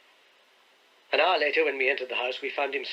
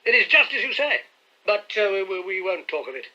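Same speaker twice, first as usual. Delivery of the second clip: flustered; 5 semitones higher, 4 dB louder than usual. A narrator's reading. Trevor flustered, changing subject.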